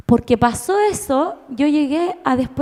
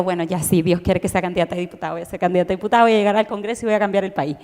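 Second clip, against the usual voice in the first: lower, fast self-mocking imitation of her younger self; playing her naive, obedient past self; mock devout resolve; 7 semitones lower, faster than usual. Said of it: "Y yo". (imitación burlona, más grave y rápida)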